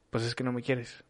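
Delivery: soft, sulky accusation of "no me quieres"; accusing tone. Shown as a demonstration. Partner accusing lover of not loving them, soft and sulky. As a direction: mutter it as a hurt reproach. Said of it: "el tono de nuestra voz dice otra".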